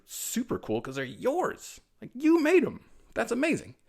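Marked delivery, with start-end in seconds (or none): 2.0-3.8 much higher, bright encouragement; excited, high-pitched delight; enthusiastic praise. Real, not acted. Enthusing to kids.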